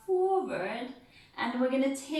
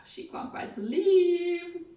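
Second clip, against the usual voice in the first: astonished; 7 semitones higher, 4 dB louder, slower than usual. Shown as a demonstration. Narrating Mrs Bennet's disbelief.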